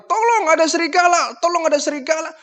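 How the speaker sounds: very high desperate call; desperate cry. Retold or imitated. Boy calling the empty village in vain, desperate. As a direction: cry out with nobody coming. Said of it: "Dia teriak lagi".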